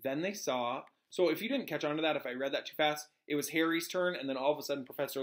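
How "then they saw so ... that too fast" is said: usual speaking voice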